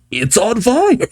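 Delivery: high mock yell; lightly voiced panicked fox; yelling Tails. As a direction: raise the alarm, comic high pitch. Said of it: "until Tails yells".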